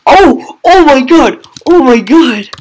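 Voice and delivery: high, very loud shock and joy; ecstatic screaming. Real, not acted. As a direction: scream in amazed triumph. Lands an amazing shot.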